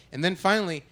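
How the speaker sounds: usual speaking voice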